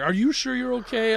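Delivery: put-on high, gentle baby-talk question; doting dad. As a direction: coo at the kid. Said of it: "I'm like".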